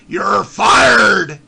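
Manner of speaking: loud mock shout of You're fired; imitating the clip. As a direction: bark the catchphrase like the overused clip. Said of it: "have you used that clip".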